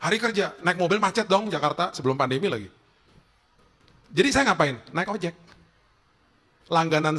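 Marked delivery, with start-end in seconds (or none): none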